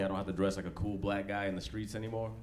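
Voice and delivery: usual speaking voice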